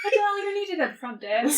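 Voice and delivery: high, amused announcer's call; mock receptionist summons with a laugh; joking front-desk page. Jokes a front-desk page for Odell.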